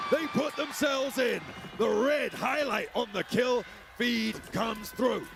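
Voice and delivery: excited call. Real, not acted. Caster calls a kill moment.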